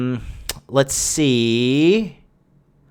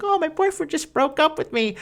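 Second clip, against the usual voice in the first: much higher, soft mock girly whine; mock sad girl; 13 semitones higher, 5 dB quieter than usual. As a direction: squeak it like a pitiful heartbroken girl.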